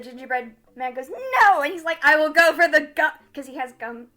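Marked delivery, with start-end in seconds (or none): 1.1-1.6 high, loud cry of refusal; hammed-up prisoner's shout; defiant yell. Gingerbread man refusing to tell, high shouted defiance. 2.0-3.1 loud, raised-pitch villain threat; shouted threat. Acted. Axe threatening the ginger's buttons, loud menace.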